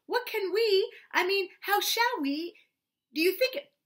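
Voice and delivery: high, halting, flustered; in distress. Acted. Pooh frets about rescuing Eeyore, high and distressed. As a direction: stumble over the words in worry. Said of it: "said Pooh in distress".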